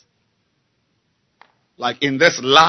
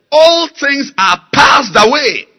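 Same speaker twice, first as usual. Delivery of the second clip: slow, loud, higher proclamation; shouted scripture; 12 semitones higher, 11 dB louder, slower than usual. Acted. Preacher proclaiming the verse, shouting.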